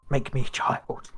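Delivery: unvoiced, slow whispered wish. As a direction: breathe it out slowly.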